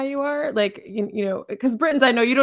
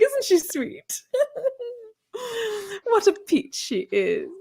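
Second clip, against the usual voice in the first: high, patronizing mock-English colleague; British accent; 8 semitones higher than usual. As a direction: marvel condescendingly in a posh British voice. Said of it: "he did the English accent".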